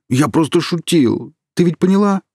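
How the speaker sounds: high, loud frantic begging; frantic pleading. A narrator's reading. Kirill begging Alina to hand him the stick. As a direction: plead desperately, slowing to insist. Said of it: "взмолился Кирилл".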